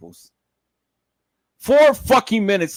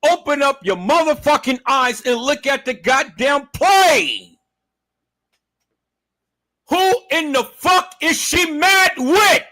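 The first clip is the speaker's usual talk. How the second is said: raised, high shout; shouting at viewers; 7 semitones higher than usual. Real, not acted. Vlogger yelling at viewers to see the play. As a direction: yell at them to wake up.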